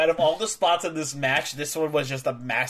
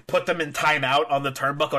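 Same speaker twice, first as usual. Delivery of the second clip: ordinary talk, nothing marked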